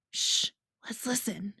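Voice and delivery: hushed whisper. A narrator's reading. Boy whispering to the group to listen, hushed. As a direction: shush the others.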